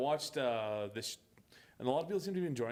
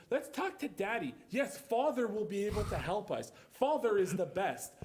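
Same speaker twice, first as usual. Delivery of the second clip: mock-stilted child lines, pitched up; stilted dialogue; 3 semitones higher than usual. Mocking the show's two daughters, stilted. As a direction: imitate stiff, over-formal scripted speech. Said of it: "the way that they talk".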